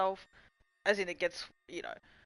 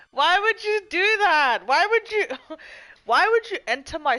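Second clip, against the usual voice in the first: outraged shout; 9 semitones higher, 8 dB louder than usual. Real, not acted. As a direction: yell at chat in mock betrayal. Outraged at chat for teasing.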